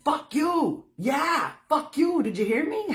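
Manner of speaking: furious high shout, voice cracking; yelling at God. Retold or imitated. Woman in her backyard yelling at God in fury. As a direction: scream it up at the sky in rage. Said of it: "I was so damn mad".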